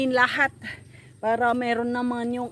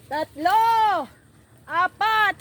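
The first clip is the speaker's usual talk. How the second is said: counting out loud, getting louder; excited loud counting; 8 semitones higher, 9 dB louder, slower than usual. Real, not acted. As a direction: call out each number with glee. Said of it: "Huwag masyado".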